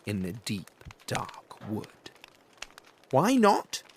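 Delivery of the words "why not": put-on high, loud little-child voice; the child demanding an answer, loud; child's question. Gruffalo's child asking her dad why not. Child asks father in story.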